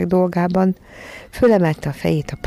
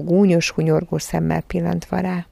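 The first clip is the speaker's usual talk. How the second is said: plain reading voice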